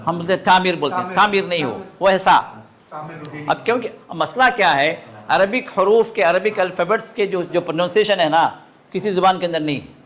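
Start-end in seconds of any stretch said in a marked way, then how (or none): none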